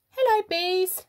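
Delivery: high, bright greeting to the class; bright high hello; cheery queen bee. Acted. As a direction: sing it out like a friendly head bee.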